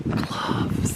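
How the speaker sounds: hushed, surprised whisper; whispered surprise. Real, not acted. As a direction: breathe it out in surprise. Finding forgotten gloves, whispered.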